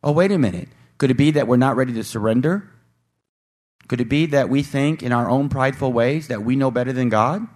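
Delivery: usual speaking voice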